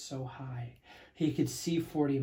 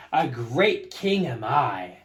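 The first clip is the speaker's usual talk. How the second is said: smug repetition; 6 dB louder than usual. Acted. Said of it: "saying over and over".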